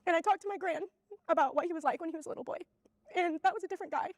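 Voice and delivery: high, tearful voice with halting pauses; crying. Real, not acted. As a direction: push the words out through real tears. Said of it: "I can't believe I'm crying on camera".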